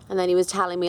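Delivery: ordinary delivery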